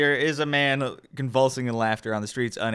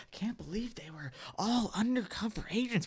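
whispered crowd gossip; 6 semitones higher, 7 dB quieter than usual. Acted. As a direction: murmur it as you walk away.

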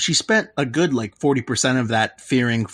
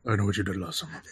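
ominous hush; 5 semitones lower, 9 dB quieter, much faster than usual. Pretend. Mock horror film title.

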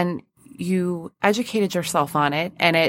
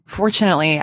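ordinary talk

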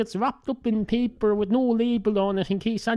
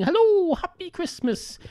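mock Christmas yell; 9 semitones higher than usual. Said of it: "start screaming and yelling and saying".

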